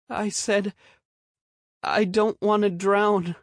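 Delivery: put-on high timid child voice; small scared boy. A narrator's reading. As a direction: play a frightened little boy.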